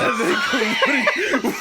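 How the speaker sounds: high, slow, laughing shout; laughing outburst. Retold or imitated. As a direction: blurt it out cracking up. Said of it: "And they go".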